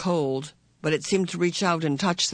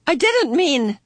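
calling after; 11 semitones higher, 7 dB louder, slower than usual. A narrator's reading. Meg calls after Calvin.

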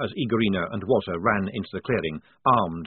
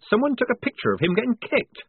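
much higher pitched alarm; horrified squeak; 13 semitones higher than usual. A narrator's reading. Polly hears there is a picture, squeaking in horror. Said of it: "squeaked Polly, drenched in a sudden horror".

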